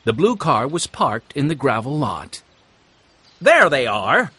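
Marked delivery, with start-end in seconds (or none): 3.4-4.3 louder, accusing call; shouting. A narrator's reading. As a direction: call them out across the yard. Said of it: "Bessie shouted".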